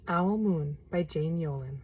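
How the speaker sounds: ordinary delivery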